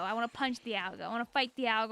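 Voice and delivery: ordinary delivery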